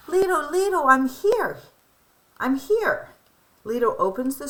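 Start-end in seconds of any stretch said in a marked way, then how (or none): 0.1-3.1 put-on high, loud, eager child's call; excited calling. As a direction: call out to grandpa, thrilled to arrive. Child arrives, calling grandfather.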